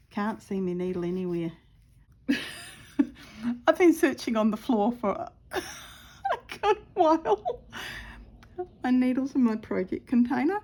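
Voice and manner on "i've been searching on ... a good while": giggling through the words, voice climbing high; real giggles breaking up the line; laughing. Real, not acted. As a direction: laugh helplessly as you talk. Laughing at lost needle.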